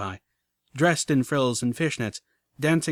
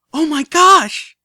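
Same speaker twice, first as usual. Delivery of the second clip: very high, loud and slow squeal; a filly squealing with joy; delighted squeal; 13 dB louder, slower than usual. Acted. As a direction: shriek with sheer delight. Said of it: "squealing in delight".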